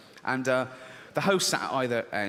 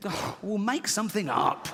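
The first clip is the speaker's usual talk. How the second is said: higher-pitched, exasperated outburst; exasperated father; 8 semitones higher than usual. Acted. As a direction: burst out at the child, fed up.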